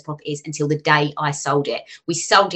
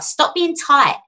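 high, teasing jab; imitating friends calling her tight; mocking jibe; 11 semitones higher, 3 dB louder, much slower than usual. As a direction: throw the jibe back in a higher mock voice. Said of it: "So many people have said that to me".

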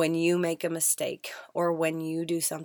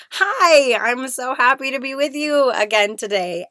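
high, loud, gushing hello; big happy squeal of a welcome; excited greeting; 8 semitones higher, 11 dB louder than usual. Real, not acted. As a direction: greet the listeners with a burst of delight. Gushing greeting to podcast listeners.

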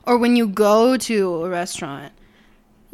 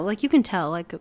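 ordinary delivery

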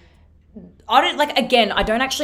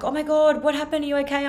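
higher, worried onlooker voice; concerned passerby; 9 semitones higher than usual. Retold or imitated. Imitating strangers on the street asking if she's okay. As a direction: mimic a startled stranger, pitched up.

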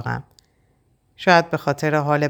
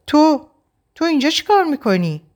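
high, louder stunned question; shocked; 13 semitones higher, 4 dB louder than usual. A narrator's reading. Shadi confronts the man at the door, shocked and high. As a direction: recoil at an unwelcome face.

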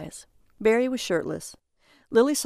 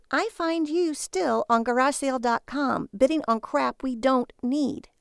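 higher, scornful nagging wife; annoyed wife voice; 6 semitones higher than usual. A narrator's reading. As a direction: voice the exasperated wife. Lily's voice in the story.

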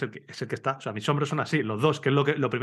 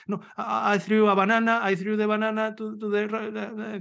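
higher, stumbling broken English; explaining a banana crash to a stranger in halting, higher English; flustered English; 8 semitones higher, slower than usual. Retold or imitated. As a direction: fumble for the English words, flustered. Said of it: "intentando explicarle en inglés".

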